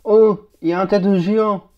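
loud, real surprise; amazed exclamation. Real, not acted. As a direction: exclaim loudly at an astonishing sight. Startled by a giant head.

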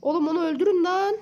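loud, high yell; urgent gamer shout; shouting at teammates. Real, not acted. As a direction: bark the order at the team. Urging teammates in firefight.

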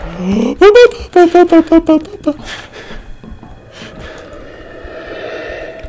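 startled gasp; sudden frightened gasp; sharp scared gasp. Real, not acted. Monster appears suddenly.

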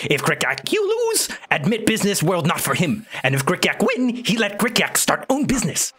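high broken-English goblin; squeaky little goblin merchant. Acted. GM holds goblin voice.

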